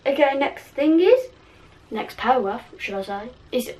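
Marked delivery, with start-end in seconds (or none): none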